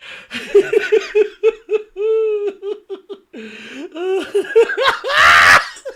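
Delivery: high, long burst of laughing; helpless laughter. Real, not acted. Host cracking up at own Hogan bit, helpless laughter. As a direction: crack up completely.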